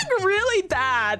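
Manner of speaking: normal talking voice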